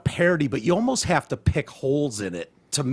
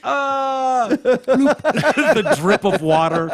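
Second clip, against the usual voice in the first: laughing; 10 semitones higher, 5 dB louder, slower than usual. Real, not acted. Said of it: "It's so funny".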